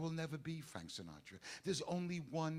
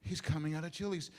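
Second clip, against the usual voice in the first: usual speaking voice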